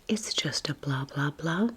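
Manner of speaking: ordinary delivery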